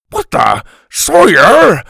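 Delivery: very loud, rising to high; startled yell. A narrator's reading. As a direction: grandpa bellowing the boy's name. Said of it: "Grandpa yelled".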